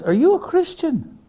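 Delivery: high, squeaky mock disbelief; incredulous high voice. Preacher voicing a scoffing pilot, squeaky and incredulous. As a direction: pitch it way up like a scoffing old friend.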